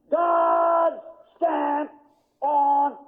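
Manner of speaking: fervent preacher's declaration; fiery sermon delivery; preaching. Acted. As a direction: proclaim it from the pulpit. Preacher declaring God's hand.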